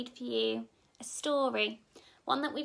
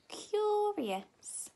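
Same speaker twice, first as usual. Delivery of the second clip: high, sing-song curious; intrigued sliding curious; 8 semitones higher than usual. Acted. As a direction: draw it up high with wonder. Curious page, big pitch rise.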